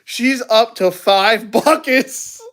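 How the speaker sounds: laughing through the words; cracking up, high and slow; can barely get it out for laughing. Real, not acted. Laughing while telling.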